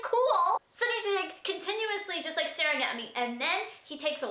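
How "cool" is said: high, louder, drawn-out "cool"; mock-breezy "cool", pitched way up; awkward cool. Driver reacting to a rude cop for no reason, awkward.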